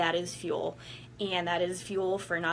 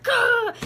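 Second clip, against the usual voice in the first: sudden high, loud yell; mock battle cry; 10 dB louder than usual. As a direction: hurl a short battle cry. Picturing the rival fighting her.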